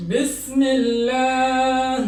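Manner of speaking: বিসমিল্লাহ chanted slowly with a light lam; thin lam. Shown as a demonstration. Teacher reciting বিসমিল্লাহ to students, slowly chanted with a thin lam. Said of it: "এটা হবে বিসমিল্লাহ".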